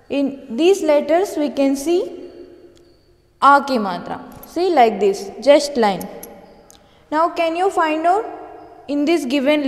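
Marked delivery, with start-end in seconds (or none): none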